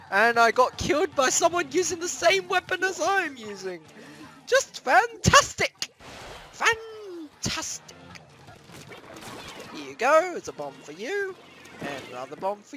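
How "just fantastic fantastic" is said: drawn-out high sarcastic fantastic. Real, not acted. Gamer killed by his own weapon, slow sarcastic groan. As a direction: sneer it out slowly.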